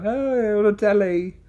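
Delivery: high-pitched British impression attempt; put-on high British voice; imitated British accent. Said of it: "He did it good a minute ago".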